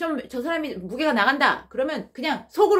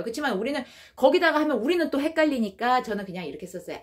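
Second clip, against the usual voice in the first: ordinary talk, nothing marked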